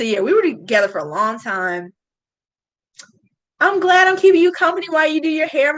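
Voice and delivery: normal talking voice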